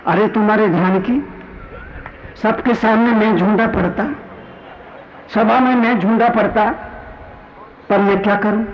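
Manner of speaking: ordinary talk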